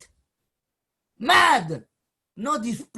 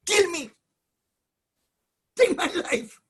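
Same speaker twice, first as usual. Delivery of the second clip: high, loud begging to die; louder, higher anguished cry; desperate plea; 8 semitones higher, 6 dB louder than usual. Acted. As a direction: cry out in despair.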